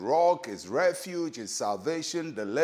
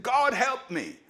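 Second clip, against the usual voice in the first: raised, higher-pitched cry for help; retold shouted plea; 8 semitones higher than usual. Preacher voicing David calling out to God, raised pleading cry. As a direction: call it out in a raised voice, not a full shout. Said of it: "he raised his voice and shouted".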